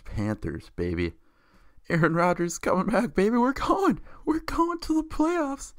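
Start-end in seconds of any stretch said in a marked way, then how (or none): 1.9-5.7 high, far-off squealing fan voice; mock Packers fan cheer. Mocking Packers fans, a squealing excited cheer. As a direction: squeal it like a giddy cheesehead.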